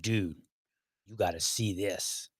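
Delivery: a hint of a conspiratorial pal; mock eager dude. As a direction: drop low and hush it like a hyped friend.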